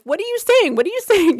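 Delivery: high, loud, acted panicked demand; flipping out. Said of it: "I'm flipping out".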